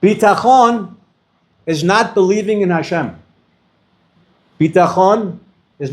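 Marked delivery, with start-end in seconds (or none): none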